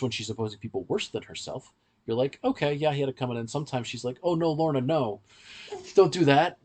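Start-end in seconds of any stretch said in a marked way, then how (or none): none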